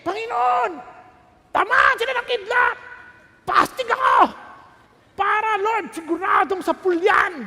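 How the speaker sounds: high, loud mock shouting prayer for revenge; hammed-up call for lightning on enemies; mock furious prayer; vengeful mock prayer. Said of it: "para gumanti".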